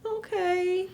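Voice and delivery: high, soft tearful okay; small sad lamb voice giving in. Retold or imitated. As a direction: sound like a rejected lamb near tears. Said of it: "the baby's just like".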